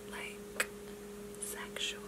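breathy near-silent whisper. Shown as a demonstration. Telling viewers how her calm voice sounds, whispering. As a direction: drop to a hushed whisper to show it. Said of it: "when I'm talking calmly to the camera".

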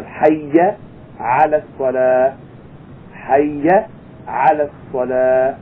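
"Hayya ala salah" with a clear a ending, chanted slowly; drawn-out "hayya ala salah"; correct hayya. Shown as a demonstration. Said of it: "instead of".

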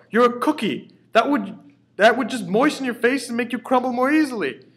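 high loud shout. A narrator's reading. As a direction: shout it, exasperated. Father shouting at cookie.

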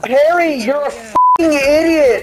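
slow exasperated yell; angry yell. Real, not acted. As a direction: yell at a teammate, drawn out. Yelling at teammate Harry.

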